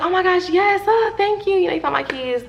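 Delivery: high, loud, gushing relief; overjoyed to get the keys back; excited thanks. Retold or imitated. Thanking the girl who found her keys, high and gushing.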